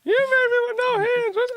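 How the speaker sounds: high, loud cartoon dinosaur whine; T-Rex voice. Voicing a frustrated T-Rex.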